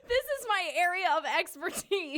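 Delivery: high, loud mock quote. Teasing the co-host who just said it, mocking. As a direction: throw their words back at them, loud. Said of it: "You just said".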